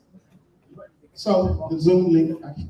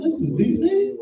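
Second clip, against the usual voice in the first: disbelieving question; 12 semitones higher than usual. Retold or imitated. Confused about location.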